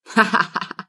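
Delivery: sudden run of staccato laughs; acted laughter. Else hears herself laughing before onlookers, involuntary laughter. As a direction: laugh out uncontrollably, in character. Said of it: "Wer lacht denn da?".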